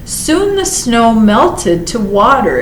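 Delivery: usual speaking voice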